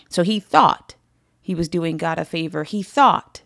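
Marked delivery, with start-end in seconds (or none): 0.1-0.9 "thought" hit higher and louder; emphasis on thought. Shown as a demonstration. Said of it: "I'm emphasizing that word thought". 2.6-3.4 "thought" hit higher and louder; emphasis on thought. Shown as a demonstration. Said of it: "I'm emphasizing that word thought".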